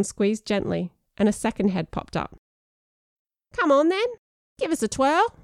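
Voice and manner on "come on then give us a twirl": high, cheeky cockney worm. Acted. Biscuit worm teasing the friend, slightly cockney worm voice. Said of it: "said a slightly cockney worm voice".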